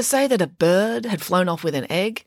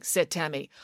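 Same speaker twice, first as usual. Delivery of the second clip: usual speaking voice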